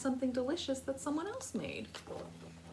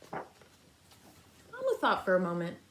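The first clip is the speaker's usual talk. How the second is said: usual speaking voice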